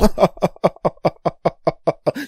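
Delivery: loud, high devilish laugh; evil clown cackle. Acted. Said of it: "an evil clown or a devil".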